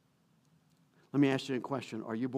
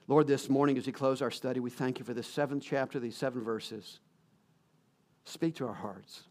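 closing prayer; 4 dB quieter than usual. Acted. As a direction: lead the church into a hushed prayer.

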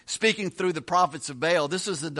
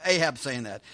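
ordinary delivery

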